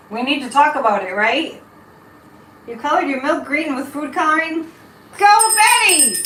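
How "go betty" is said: high, loud cheer for a viewer; cheering someone on, thrilled; excited cheer. Real, not acted. Host cheers on a viewer Betty, excited high shout.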